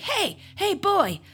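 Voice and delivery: high, eager hail from a window; Scrooge calling out. Retold or imitated. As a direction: imitate an excited man calling down to a boy. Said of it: "and he's like".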